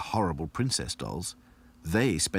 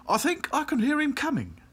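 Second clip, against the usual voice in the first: high, flustered warning; quick alarm. A narrator's reading. Back to Front warning of the wizard, alarmed. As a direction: warn them in feigned panic. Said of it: "said Back to Front quickly".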